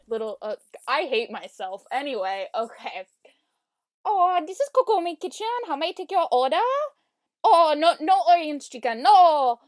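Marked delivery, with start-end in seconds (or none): 4.1-9.6 put-on Chinese accent, pitched up; hammed-up Chinese restaurant voice; fake Chinese accent. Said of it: "my racist Chinese accent".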